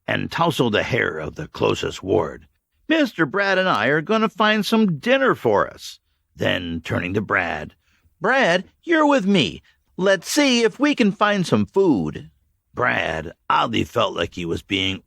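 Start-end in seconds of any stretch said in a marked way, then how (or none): 2.9-12.3 higher, brisk leader voice; Mags in charge. A narrator's reading. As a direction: take charge of the boy. Mags gives orders.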